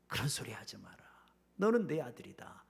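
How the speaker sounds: the father whispering softly to his son; tender whisper. Acted. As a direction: hush him gently, forgiving. Father embraces returning son. (아들을 안고 다정하게 속삭이는 아버지)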